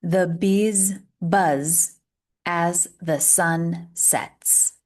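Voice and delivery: slow, clear tongue twister; slow teacher model; slow model reading. Shown as a demonstration. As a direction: read it slowly for learners. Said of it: "Repeat after me".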